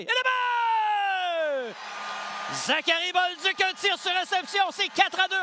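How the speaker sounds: long drawn-out goal shout; excited goal call. Real, not acted. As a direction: stretch out le but. Goal call by play-by-play announcer. (but étiré et crié)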